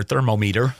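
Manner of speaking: thermometer said as "thermo-meeter"; odd thermometer. Shown as a demonstration. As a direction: stress the MO in thermometer, long "mee". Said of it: "I said it that way on purpose".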